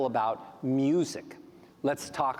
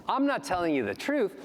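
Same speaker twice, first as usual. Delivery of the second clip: ordinary delivery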